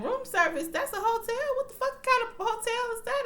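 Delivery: high, shocked disbelief; high-pitched what-the-heck reaction; incredulous squeal. Retold or imitated. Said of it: "And I'm like".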